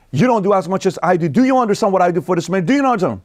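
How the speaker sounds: high, indignant wife voice; mocking resentful wife. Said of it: "and then, you know".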